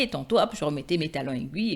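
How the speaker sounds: ordinary delivery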